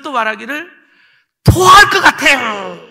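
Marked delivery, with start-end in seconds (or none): 1.4-2.8 high, loud, drawn-out retold outburst; urgent nauseous cry. Boy telling his teacher he feels sick, urgent cry. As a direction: blurt it out loudly, stretching the words.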